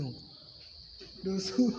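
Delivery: ordinary talk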